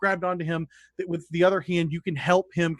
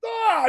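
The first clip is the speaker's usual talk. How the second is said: high-pitched "ah" in mid-action; acted cry. Game master voices player firing blindly, high cry. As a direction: cry out high as you fire. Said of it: "and you just are like".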